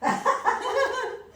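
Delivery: high burst of laughter; a quick, high-pitched laugh. Real, not acted. Bursts out laughing before the reading.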